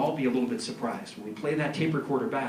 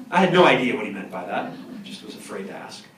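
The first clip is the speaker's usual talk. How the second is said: ordinary talk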